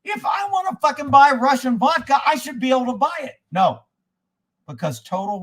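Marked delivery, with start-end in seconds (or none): 0.0-3.4 furious retold protest; angry rant. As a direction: rage at the store like it's personal. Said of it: "I was like".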